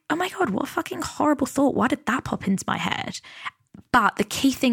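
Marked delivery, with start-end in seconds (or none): 0.1-3.2 mock horror, pitched up; appalled self-reaction. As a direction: react aghast at your own thought. Acts out her shocked reaction.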